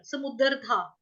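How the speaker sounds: normal talking voice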